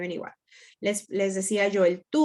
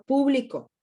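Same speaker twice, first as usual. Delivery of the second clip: PÚ-bli-co, first syllable stressed in "público"; 3 semitones higher, 3 dB louder than usual. Shown as a demonstration. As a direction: hit the first syllable of "público", then let it fall. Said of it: "con diferente, eh, acentuación".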